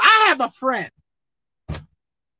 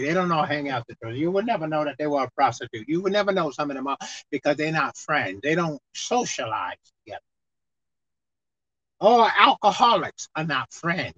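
ordinary talk, nothing marked

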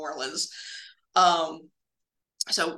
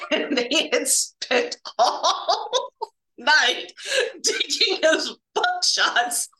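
words breaking up with laughter, higher pitched; laughing while telling; 7 semitones higher than usual. Real, not acted. Telling coworker the buckshot story, laughing hard. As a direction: crack up through the telling, pitch climbing.

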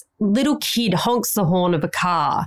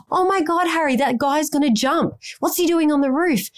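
high, alarmed cry to her husband; retold sudden panic, pitched up; panicked alarm; 8 semitones higher than usual. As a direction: blurt it out as if someone's about to jump. Panicked at man on roof.

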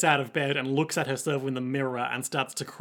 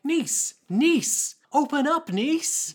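put-on very high boy calling at a door; high-pitched young boy; Jacuzzi; 10 semitones higher, much slower than usual. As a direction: call out in an unbroken child's voice. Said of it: "One whose voice is yet to break".

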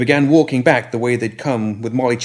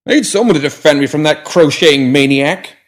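put-on higher, louder prim old gentleman; grumpy old gentleman; 6 semitones higher, 8 dB louder than usual. A narrator's reading. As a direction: voice him as a fussy old man. Pram complains.

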